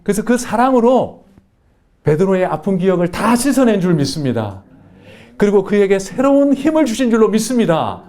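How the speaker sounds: rising, building sermon cadence; fervent preaching. Acted. Pastor preaching to the congregation, building fervour. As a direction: preach it to the congregation with growing fire.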